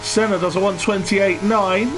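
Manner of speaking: game commentator. Acted. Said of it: "Why do I sound like a game commentator?".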